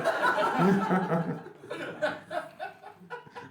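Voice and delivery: rising evil villain laugh; mock Bond villain cackle. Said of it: "ce rire de méchant de James Bond".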